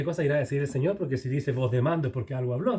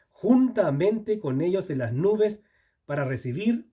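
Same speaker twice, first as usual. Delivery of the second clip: scripture reading; 4 semitones higher, 3 dB louder, slower than usual. Acted.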